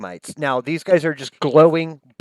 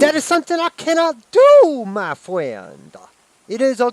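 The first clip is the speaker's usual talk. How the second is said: smug invisible creature voice; 9 semitones higher, 6 dB louder than usual. Acted.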